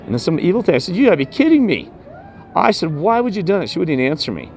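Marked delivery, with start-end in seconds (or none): 0.9-3.7 high-pitched, stunned outrage; pitched way up in shock; outraged disbelief. Retold or imitated. Reacting to the smashed mirror.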